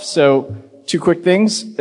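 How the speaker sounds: ordinary talk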